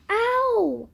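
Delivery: sharp high yelp of pain; scream. A narrator's reading. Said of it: "he screamed".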